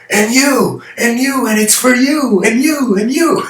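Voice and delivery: high, loud hammed-up politician voice; mock politician. A politician greeting each person in the crowd, hammed up. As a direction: point at each one like a glad-handing politician. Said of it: "like a politician".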